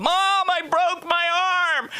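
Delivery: put-on high, slow whiny child wail; mock child whining about a broken arm; kid crying to mom. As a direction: wail it like a hurt little kid. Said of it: "you go".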